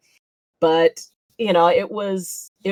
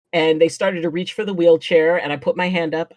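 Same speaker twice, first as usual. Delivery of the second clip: normal talking voice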